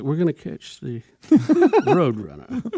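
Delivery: slow, hammed-up British accent with a laugh. Co-host imitates the cartoon coyote, slow British accent. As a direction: draw it out in a mock-British accent. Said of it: "I want him to have a British accent".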